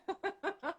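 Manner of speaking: soft laugh. Real, not acted. After cheering winner.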